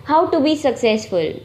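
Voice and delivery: ordinary talk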